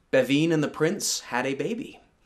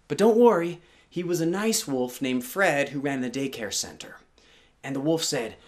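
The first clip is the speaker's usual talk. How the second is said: usual speaking voice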